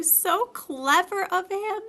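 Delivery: high, slow squeaky imitation; mother playfully copying Piglet; Kanga's mock Piglet voice. A narrator's reading. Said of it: "imitating Piglet's voice too".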